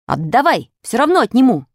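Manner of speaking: forceful bullying order; insistent threat. A narrator's reading. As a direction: demand it and threaten to take it. Boy repeats his stern demand.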